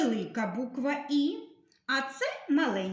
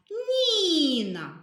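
«ніна» said higher and louder, stretching the «і»; stress on the first syllable; 7 semitones higher, 5 dB louder than usual. Shown as a demonstration. Teacher to class, drawing out the stressed «і» loudly. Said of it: "Який звук ми тягнемо голосом?".

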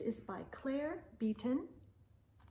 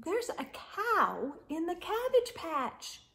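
lively read-aloud voice, higher and bright; storybook reading; 8 semitones higher, 4 dB louder than usual. A narrator's reading. Storyteller reading the first page to children, lively. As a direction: read it out like a picture book to small kids.

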